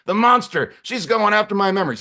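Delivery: high, loud mock hysteria; losing his chips. Claudio believes she attacks his memories, losing his chips. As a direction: wail in outrage, coming unhinged. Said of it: "Claudio's losing his chips".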